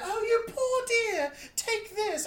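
put-on high, cooing British old lady; put-on British accent. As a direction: fuss pityingly in mock British. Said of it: "I don't know why she became British".

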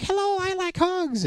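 slow, high toy Olaf greeting. Retold or imitated. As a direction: chirp it slowly like a toy snowman. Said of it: "And he's like".

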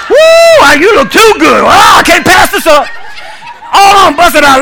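high, loud whooping shout; hammed-up infatuation. Waiter mock-courting an old lady, hammed-up infatuation. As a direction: whoop and holler as if love-struck.

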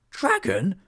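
high, loud startled cry; shocked disbelief. Acted. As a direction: blurt it out in alarm. Friends shocked to hear of dragon.

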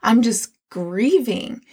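ordinary talk, nothing marked

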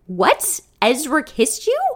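gasping in disbelief; shocked, high and loud. Acted. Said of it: "she gasps".

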